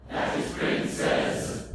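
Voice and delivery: loud, slow rhythmic whisper; forceful drawn-out unvoiced words. Shown as a demonstration. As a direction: chant it voicelessly in strict time. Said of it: "You've got to get on with the word".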